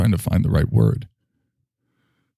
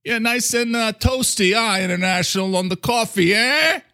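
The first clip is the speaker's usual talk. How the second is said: very high put-on character voice with an accent; Mister Coffee. Coffee character chatting to the host, high accented voice. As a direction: squeal it as a cartoon coffee machine. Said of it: "you're doing my accent really good right now".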